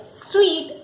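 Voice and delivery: "suite" pronounced like sweet; correct suite. Shown as a demonstration. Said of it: "but it is a suite".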